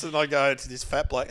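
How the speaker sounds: ordinary talk, nothing marked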